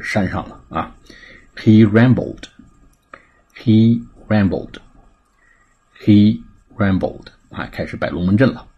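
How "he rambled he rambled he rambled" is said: slow, louder, word-by-word repeat-after-me delivery; drawn-out teaching demo with separated words; slow model reading. Shown as a demonstration. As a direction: model it slowly for learners to repeat. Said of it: "来做跟读练习".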